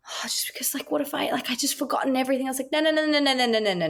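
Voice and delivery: high, fretful student babble, starting hushed; imitated freaking out. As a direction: imitate a panicking new grad rattling on. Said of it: "freaking out".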